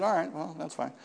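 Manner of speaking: softly tossed-off agreement; retold quiet concession. Retold reply, conceding the deposit.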